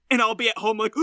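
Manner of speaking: normal talking voice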